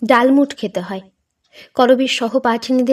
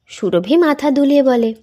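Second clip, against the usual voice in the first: usual speaking voice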